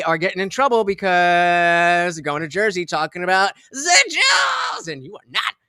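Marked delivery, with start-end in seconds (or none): 3.7-4.9 shouting. Pretend. Streamer exclaims mid-sentence.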